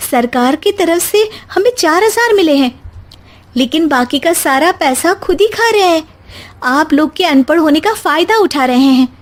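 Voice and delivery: wife outraged at officials; indignant young wife. Acted. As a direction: expose the cheats to husband. Anjali explains the cheating.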